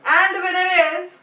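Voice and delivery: loud, high mock announcer; hammed-up winner announcement. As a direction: announce it grandly, holding for suspense. Tries the announcement once more.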